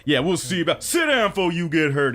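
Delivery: hammed-up bar bravado; tough bouncer quote. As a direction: imitate a bouncer's warning. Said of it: "is what you told him".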